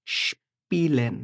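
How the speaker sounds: স্পিলেন drawn out slowly with a clear shp sound; teacher modelling the shp sound slowly for students; slow model word. Shown as a demonstration. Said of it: "এটার উচ্চারণ হচ্ছে স্প".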